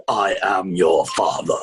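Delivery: very deep, slow villain voice; booming-deep film villain impression; Darth Vader. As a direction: intone it low and slow, like a dark lord. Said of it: "Darth Vader".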